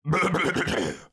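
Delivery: loud, showy throat-clearing; impressive cough. A narrator's reading. Eeyore about to begin his speech, impressive cough. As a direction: cough importantly to get attention. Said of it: "coughed in an impressive way".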